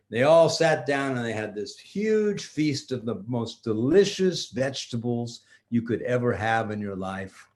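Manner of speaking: ordinary delivery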